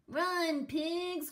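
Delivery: high, loud shout; urgent cry. A narrator's reading. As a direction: shout the warning. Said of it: "cried Fluffy the Explorer".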